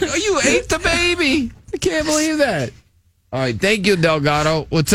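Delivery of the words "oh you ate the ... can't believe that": high-pitched mock child voice, aghast; shocked kid. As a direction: squeal it like a horrified little kid. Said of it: "the kid's like".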